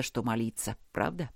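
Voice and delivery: plain reading voice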